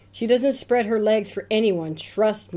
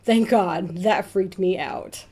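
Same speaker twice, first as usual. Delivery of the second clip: ordinary talk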